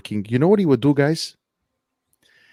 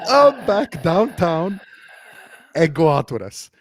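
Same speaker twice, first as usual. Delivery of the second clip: usual speaking voice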